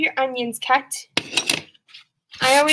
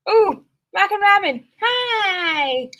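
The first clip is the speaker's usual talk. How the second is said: excited greeting; 7 semitones higher, much slower than usual. Real, not acted. Greeting chat viewers excitedly.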